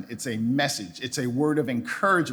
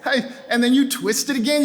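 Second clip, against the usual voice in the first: ordinary delivery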